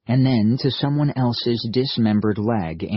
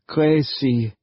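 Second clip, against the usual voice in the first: bemused German soldier; 5 semitones higher than usual. Acted. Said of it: "managed a bemused grin".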